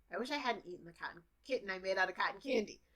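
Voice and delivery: fast, native speaker pace; native speaker speed. Shown as a demonstration. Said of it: "as a native speaker".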